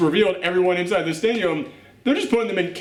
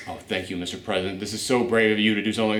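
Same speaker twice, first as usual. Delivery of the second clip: imitating the grateful advisor; 8 semitones lower, 4 dB quieter, faster than usual. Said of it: "And she goes".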